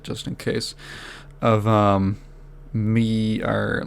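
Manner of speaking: ordinary talk, nothing marked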